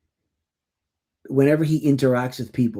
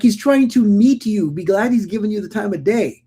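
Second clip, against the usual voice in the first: higher, louder, pleading; exasperated higher plea; 8 semitones higher, 5 dB louder than usual. Retold or imitated. Mom to the stranger, exasperated and louder. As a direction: mom pushing back, hard. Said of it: "And I was like".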